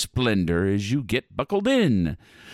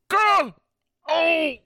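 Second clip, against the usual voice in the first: high loud yelp; pained cry; 3 dB louder than usual. Acted. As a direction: yelp as you're hit. Santa struck.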